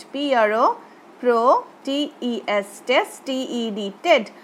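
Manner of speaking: protested spelt letter by letter: পি আর ও, প্রো, টি ই এস, টেস, টি ই ডি, টেড; spelling out syllables. Shown as a demonstration. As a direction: break প্রোটেস্টেড into its letters and syllables, slowly. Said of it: "এইভাবে syllable ভেঙে ভেঙে পড়লে". (প্রোটেস্টেড অক্ষর ধরে ধরে, syllable ভেঙে ধীরে ধীরে বানান)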